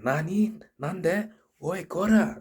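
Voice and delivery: high, loud acted outburst; mock angry Japanese. Japanese woman at the person who bumped her, mock furious. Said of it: "she's speaking Japanese and she's getting mad".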